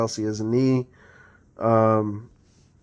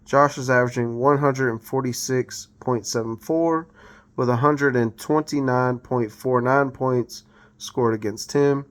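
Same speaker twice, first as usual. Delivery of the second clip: normal talking voice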